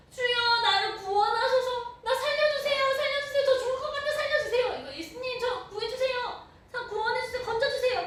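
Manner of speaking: high, loud, desperate shouting; crying out for rescue. Acted. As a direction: scream for help while sinking. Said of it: "그래서 이제 소리를 지르죠".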